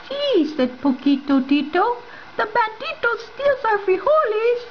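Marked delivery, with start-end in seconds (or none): 0.1-0.4 high little dog voice; high squeaky dog voice. Acted. Character Poquito speaks. 2.4-4.7 high little dog voice; high squeaky dog voice. Acted. Character Poquito speaks.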